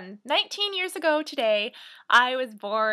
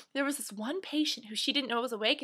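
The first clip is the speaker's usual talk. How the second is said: ordinary talk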